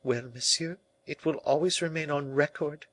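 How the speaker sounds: high, sincere woman's voice; earnest La Valliere. A narrator's reading. La Valliere answers Colbert on the king's honor, earnestly. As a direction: answer high, rising toward the end.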